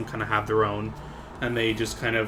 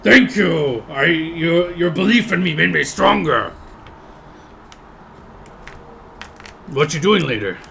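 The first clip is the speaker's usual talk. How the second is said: somewhat higher, louder eager orc; grateful orc; 5 semitones higher, 4 dB louder than usual. Acted. Winning orc thanking the player who bet on him, eager and louder. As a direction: gush thanks at your biggest fan. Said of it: "he kinda turns to you and he's like".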